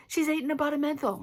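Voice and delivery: loud, high mock alarm; alarmed report. Nurse to the surgeon on the phone, loud and high. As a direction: shout it down the phone in mock panic. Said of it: "She's like".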